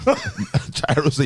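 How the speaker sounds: slow, laughing through words; giggling while telling it. Real, not acted. Retelling a funny moment, laughing.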